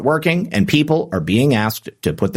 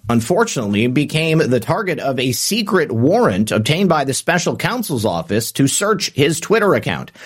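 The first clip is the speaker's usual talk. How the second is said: normal talking voice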